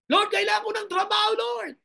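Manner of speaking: high, loud begging prayer; pleading cry. Acted. Preacher voicing a struggling worker's cry. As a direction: beg the Lord for help, voice straining. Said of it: "Dapat ganyan ang iyak natin".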